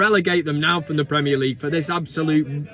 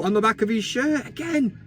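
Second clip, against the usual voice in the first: normal talking voice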